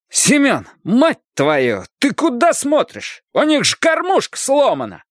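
high, indignant yell; angry scolding. A narrator's reading. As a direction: tear into a careless workmate, pitch shooting up. God scolds his careless workmate. (возмущённый крик, распекает напарника)

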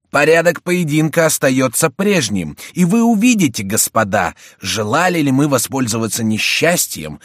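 loud, high, formal proclamation; commanding cry. A narrator's reading. Келюс sets the duel's terms for both sides, commanding. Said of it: "вскричал молодой человек".

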